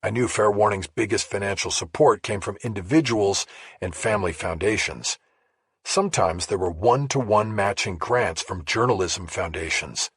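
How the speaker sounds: usual speaking voice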